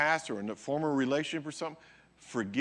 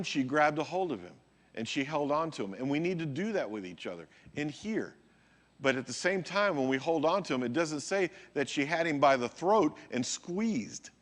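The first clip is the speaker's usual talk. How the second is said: ordinary talk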